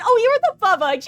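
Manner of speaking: high, loud surprised recognition; sudden excited recognition, yelled. Real, not acted.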